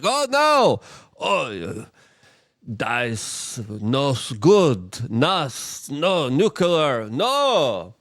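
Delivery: high, whiny mock panic with slow, dragged words; hammed-up horror at nuclear power. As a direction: ham up a fretful green activist. Mocking green Germans' panic.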